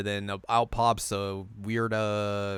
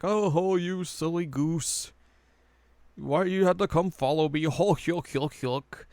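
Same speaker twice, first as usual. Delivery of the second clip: high, put-on simpering voice; teasing dream woman; 7 semitones higher than usual. Imitating Kikyo in the dream, mock teasing. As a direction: tease in a high, drawn-out mock chuckle. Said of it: "and she's like".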